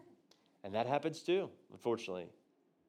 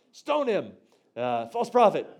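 higher and louder, jokingly calling for his stoning; mock outraged crowd; 4 semitones higher, 8 dB louder, slower than usual. Playing the crowd that turns on a missed prophecy, mock outrage. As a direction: ham up a scandalized heckler.

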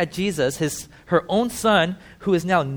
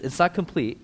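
ordinary delivery

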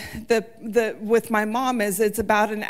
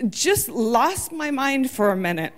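ordinary delivery

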